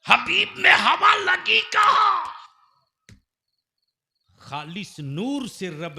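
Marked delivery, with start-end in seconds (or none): 0.1-2.3 high, loud pulpit cry; full-force preaching shout; shouted challenge. Real, not acted. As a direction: hurl the question at the congregation. Shouted rhetorical question.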